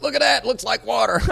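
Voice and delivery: high, loud gleeful shout; mock excitement. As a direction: show it off at full volume, about to crack up. Mock boast about tarp-filled pool.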